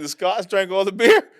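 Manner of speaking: high, loud Scottish brogue; put-on Scottish accent. Joking about fans draining the bars, loud Scottish accent. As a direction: crow it in a broad Scottish accent. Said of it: "Or the Scottish".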